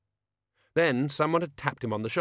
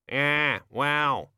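muttering raven; 5 semitones higher, slower than usual. Acted. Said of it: "muttered a voice from behind the beer nuts".

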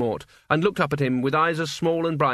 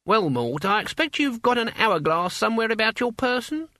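put-on high, crackly old woman; old witch voice; 8 semitones higher than usual. A narrator's reading. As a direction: play an elderly witch, brisk. Witch voice in narration.

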